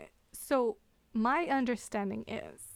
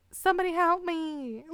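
higher-pitched mock cry for help; acted plea for help; 7 semitones higher, 4 dB louder than usual. Storyteller voices the falling baker, mock desperate plea. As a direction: plead weakly for rescue, hammed up. Dying character calls for help.

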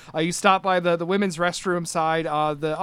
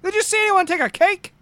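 put-on high, indignant old man ranting; fired-up old knight; 11 semitones higher, 4 dB louder than usual. Old knight Cecil fumes to coworkers about the stolen cake, high and indignant. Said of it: "so he says".

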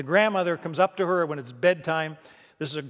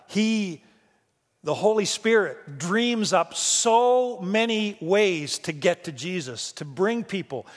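full-force preaching; 3 semitones higher than usual. Real, not acted. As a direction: proclaim it with conviction.

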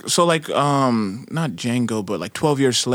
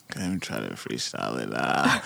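ordinary delivery

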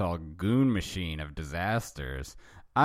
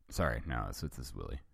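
ordinary delivery

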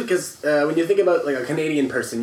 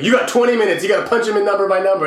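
higher, louder urgent warning; urgent call; 6 semitones higher, 6 dB louder than usual. Retold or imitated. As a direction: rush in and call the deadline. Someone runs in calling out.

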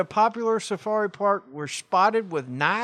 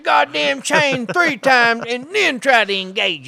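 loud, higher-pitched scolding father; angry dad; 6 semitones higher, 5 dB louder than usual. Retold or imitated. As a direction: yell instructions like an impatient dad. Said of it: "an asshole like my dad".

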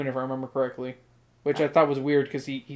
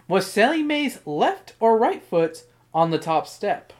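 normal talking voice

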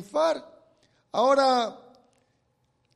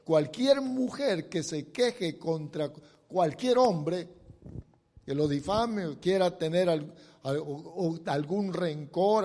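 ordinary delivery

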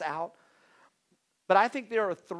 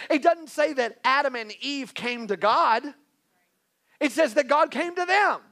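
louder, higher, driving sermon voice; full-force preaching; 7 semitones higher, 6 dB louder than usual. Real, not acted. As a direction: preach it hard to the congregation.

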